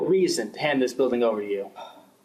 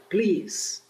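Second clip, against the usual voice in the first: usual speaking voice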